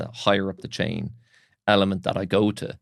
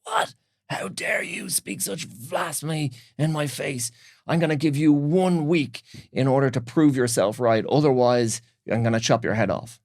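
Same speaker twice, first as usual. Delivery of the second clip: mock-regal outrage, pitched high; high, indignant storytelling voice, settling toward the end; outraged king; 6 semitones higher, 3 dB louder than usual. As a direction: act out an offended king's threat. Acting an outraged king threatening servant.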